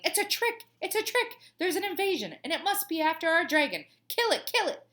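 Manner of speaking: high, loud cries of alarm; high-pitched urgent shouting; yelling. A narrator's reading. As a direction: yell it like a mob raising the alarm. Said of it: "all yelling".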